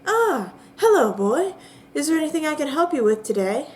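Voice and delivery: bright, quick greeting in a high voice; high woman voice. Acted. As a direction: sing out a cheery welcome. Woman greets player character.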